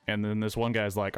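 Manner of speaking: normal talking voice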